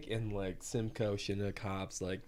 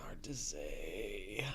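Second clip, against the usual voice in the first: whispered; 6 dB quieter, much slower than usual. Real, not acted. Trails off, unsure of rating.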